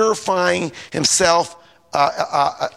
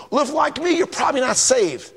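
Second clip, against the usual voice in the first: high-pitched mock judgmental voice; threatening; 9 semitones higher than usual. Preacher mimicking a church member judging you, threatening. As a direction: imitate a self-righteous threat, pitched up. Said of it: "and they threaten you".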